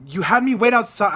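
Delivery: retold angry protest; really upset. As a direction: throw the accusation at them. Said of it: "I'm getting really upset".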